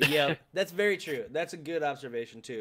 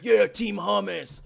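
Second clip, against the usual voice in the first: slow, louder dopey cheer of the slogan; dopey fan cheer; 7 semitones higher, 11 dB louder, slower than usual. Retold or imitated. Mocking fans who cheer the slogan, slow and loud. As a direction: drag out the slogan like a clueless fan. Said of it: "if everyone's like".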